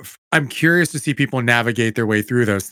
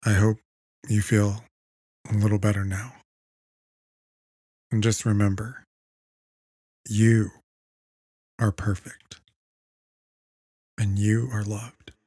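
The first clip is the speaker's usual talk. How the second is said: guided meditation; 4 dB quieter than usual. Acted. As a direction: guide them into calm, softly.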